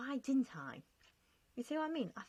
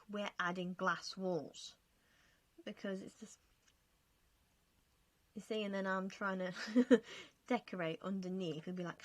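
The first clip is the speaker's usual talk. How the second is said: ordinary talk, nothing marked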